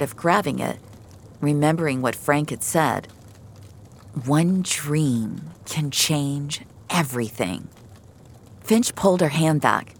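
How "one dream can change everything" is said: slow, deliberate delivery; slow, weighty recollection. Acted. Rescuer remembering what a man said, slow and weighty. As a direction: let each word sink in. Remembered words of another character.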